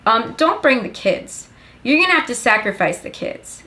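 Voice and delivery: ordinary talk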